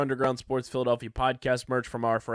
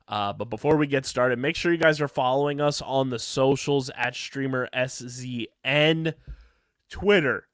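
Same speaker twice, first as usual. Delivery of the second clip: normal talking voice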